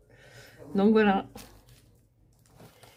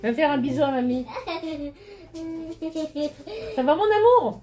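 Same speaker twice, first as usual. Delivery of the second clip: grandma voice; 11 semitones higher, 9 dB louder than usual. Real, not acted. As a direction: coo at a little granddaughter. Grandma calls her granddaughter.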